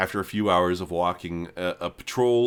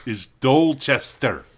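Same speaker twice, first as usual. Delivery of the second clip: "Dorchester" stretched out in a slow, louder threat; slow, louder guard demand; 4 semitones higher, 6 dB louder, slower than usual. Acted. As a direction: press the stranger hard, slowly.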